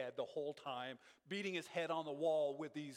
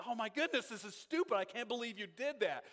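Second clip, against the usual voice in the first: what the polite saying really means, exasperated and higher; exasperated disbelief; 5 semitones higher than usual. Retold or imitated. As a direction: play the thought behind the polite phrase, exasperated. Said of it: "It's like, you're just being".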